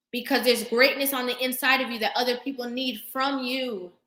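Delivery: ordinary delivery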